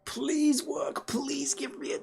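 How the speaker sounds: squeaky high begging; high-pitched pleading. Real, not acted. Streamer begging a download to start, high-pitched pleading. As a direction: coax it in a tiny high voice.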